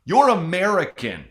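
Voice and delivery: high, loud comic insistence; exasperated rebuttal. Acted. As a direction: set them straight, loudly. Acted rebuttal to the Irish claim.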